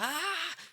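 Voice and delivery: high mock yell at a partner. Mimicking a partner who keeps yelling, mock yell. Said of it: "você tá lá".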